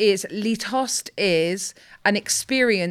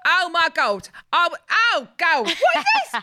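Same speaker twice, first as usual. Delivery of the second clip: hammed-up [name], shouting with laughter in the voice; [name] impression; 9 semitones higher than usual. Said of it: "can you do an [name] impression?".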